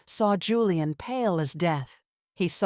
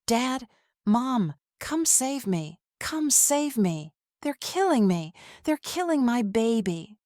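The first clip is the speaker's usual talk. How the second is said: tearful plea; 6 semitones higher than usual. Acted. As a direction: beg her parents to come save her. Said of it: "I burst into tears".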